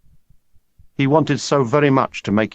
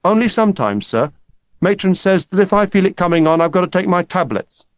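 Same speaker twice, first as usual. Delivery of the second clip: high, pleading schoolboy; 8 semitones higher, 4 dB louder than usual. Acted. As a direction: plead with the teacher, voice pitched up. Boy pleading with teacher.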